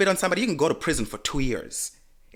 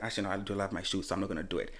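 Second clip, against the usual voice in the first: much softer muttered thought; to herself; 6 semitones lower, 10 dB quieter, much faster than usual. Retold or imitated. Deciding not to throw her shoe, soft muttered aside. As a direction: talk yourself out of it softly. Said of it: "I was like".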